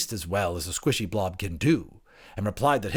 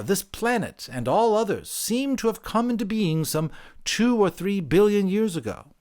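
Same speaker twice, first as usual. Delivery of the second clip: higher, solemn creation tale; creature storyteller; 9 semitones higher, 5 dB louder than usual. Acted. Creature telling the anthropologist its creation account, higher. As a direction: tell it as sacred science.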